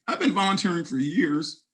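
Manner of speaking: normal talking voice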